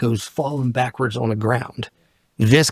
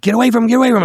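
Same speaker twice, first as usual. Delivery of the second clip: high, urgent mock shout; imitated police order; 11 semitones higher, 4 dB louder, much faster than usual. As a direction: mimic cops calling out, pitch jumping up. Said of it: "And they're like".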